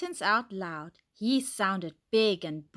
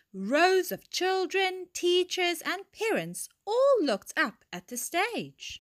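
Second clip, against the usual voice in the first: ordinary delivery, nothing marked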